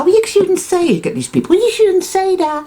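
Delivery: mock a fussy voice wagging its finger; prissy scolding voice. Inner voice scolding him over his language, in a high prim mock tone.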